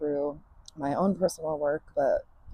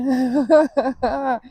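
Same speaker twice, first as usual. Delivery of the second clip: a high, loud, bright burst of real laughter; 8 semitones higher, 7 dB louder than usual. Real, not acted. Laughs mid emotional speech.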